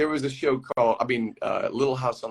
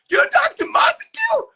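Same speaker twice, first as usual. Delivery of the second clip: very high, loud, near-crying squeal; thrilled disbelief; 5 dB louder than usual. Retold or imitated. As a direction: shriek it in overjoyed recognition. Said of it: "He goes".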